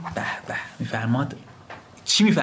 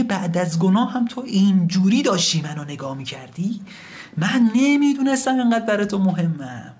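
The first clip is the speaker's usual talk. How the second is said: high, pleading cry of regret; impassioned lament; 8 semitones higher than usual. Acted. Returning sinner speaks to God, pleading lament.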